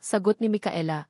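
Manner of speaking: usual speaking voice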